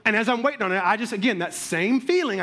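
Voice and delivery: usual speaking voice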